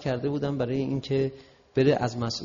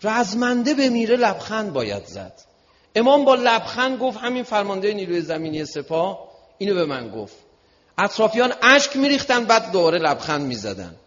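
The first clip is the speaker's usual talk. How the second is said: high, loud, emphatic oratory; impassioned preaching; 10 semitones higher, 9 dB louder than usual. Real, not acted. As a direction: drive each point home to the crowd. Preaches with rising force.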